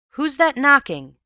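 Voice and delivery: loud, high call from upstairs; startled night-time shout. A narrator's reading. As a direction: shout the question down the stairs. Ewbank calls from upstairs.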